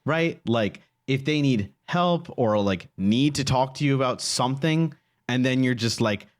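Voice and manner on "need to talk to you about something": ordinary delivery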